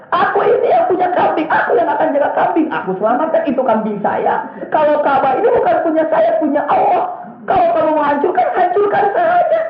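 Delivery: high, laughing Abdul Muttalib voice, carefree; tossing off the threat with a laugh; laughing retort. Retold or imitated. Preacher voicing Abdul Muttalib telling Abraha to go ahead and destroy, laughing.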